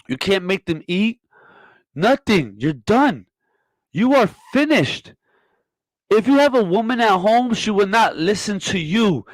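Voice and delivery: high-pitched, emphatic tirade; heated scolding. Acted. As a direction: lay into them with mounting indignation. Heated scolding rant at guest.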